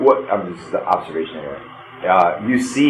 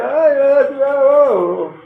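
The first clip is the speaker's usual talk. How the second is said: high, wordless-sounding holler; garbled distant yell; much slower than usual. Acted. Game master voices warning players failed to make out, garbled yell. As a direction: blur the shouted words into vague vowels. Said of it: "If you didn't, you hear".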